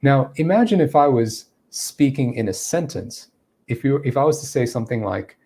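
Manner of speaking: ordinary delivery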